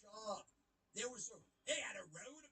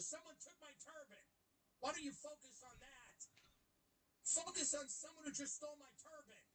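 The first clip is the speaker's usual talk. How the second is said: higher-pitched mock outrage; mock indignation; 6 semitones higher than usual. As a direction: play the aggrieved victim, hammed up. Rehearses indignant reply to British host.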